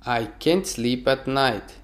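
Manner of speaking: normal talking voice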